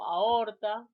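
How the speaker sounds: normal talking voice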